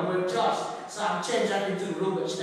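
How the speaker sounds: ordinary delivery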